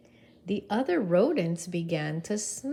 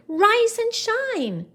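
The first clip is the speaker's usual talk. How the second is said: high, loud urging; yelling; 8 dB louder, slower than usual. Acted. Camilla shouts at another leaf, loud and high.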